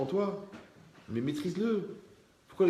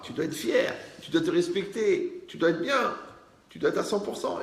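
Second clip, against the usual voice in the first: exhortation qui monte; 6 semitones higher, 3 dB louder than usual. Acted. Exhortation montante à un élève.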